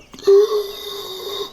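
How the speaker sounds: long high gasp of realization; drawn-out horrified intake; shocked gasp. Shown as a demonstration. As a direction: gasp as if the twist just hit you. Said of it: "look in the mirror and go like".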